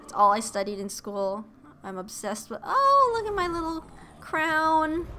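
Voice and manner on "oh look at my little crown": high, loud gush of delight; suddenly thrilled, high-pitched cooing; delighted squeal. Real, not acted. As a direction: squeal over something adorable. Delighted by cute game character.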